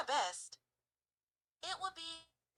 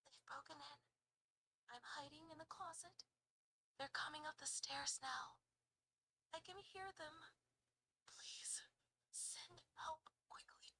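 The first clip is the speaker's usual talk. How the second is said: very soft, dropping into whisper; hushed frightened plea; 10 dB quieter than usual. Acted. Hiding in closet on emergency call, hushed whisper. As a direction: whisper haltingly so the intruder won't hear. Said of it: "your default voice, please".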